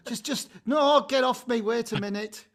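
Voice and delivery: high fending-off cry as the husband; imitating the husband. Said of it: "You know, I think".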